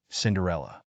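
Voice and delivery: ordinary delivery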